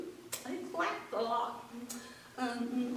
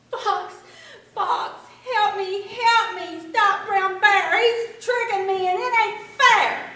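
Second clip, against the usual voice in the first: high loud plea; rabbit calling out; 8 semitones higher, 11 dB louder than usual. A narrator's reading. Rabbit begs Fox loudly to stop Brown Bear. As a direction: call to Fox urgently.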